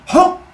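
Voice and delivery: loud, high mock gulp; sharp acted "hoop"; gulping noise. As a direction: imitate swallowing with a sudden whoop. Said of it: "takes her, and goes".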